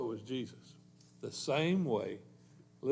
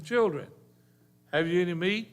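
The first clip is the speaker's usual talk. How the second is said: raised, higher call across the water; Jesus calling out; 5 semitones higher, 5 dB louder than usual. Acted. Jesus calls from shore to the disciples, raised voice.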